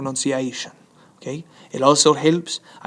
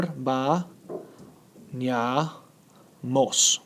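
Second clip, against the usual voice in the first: "ba, ña, mos" split into three slow beats with pauses; syllable by syllable; 5 dB quieter, much slower than usual. Shown as a demonstration. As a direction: pause after "ba" and after "ña". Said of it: "We divide it right there".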